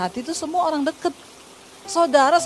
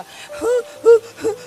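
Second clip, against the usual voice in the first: imitated sobbing gasps; 9 semitones higher, 5 dB louder, much slower than usual. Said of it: "Nangis menjerit-jerit".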